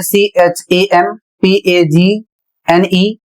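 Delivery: very slow, letter by letter; spelling out. Shown as a demonstration. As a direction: spell it slowly in grouped letters. Teacher spells out a word. (बहुत धीरे, अक्षर-अक्षर)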